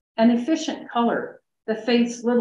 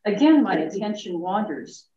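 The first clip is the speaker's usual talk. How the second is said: usual speaking voice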